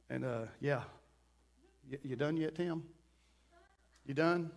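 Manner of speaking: low, quiet, absent-minded replies; distracted half-listening. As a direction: mumble low acknowledgements, barely paying attention. Acted distracted half-listening to friend.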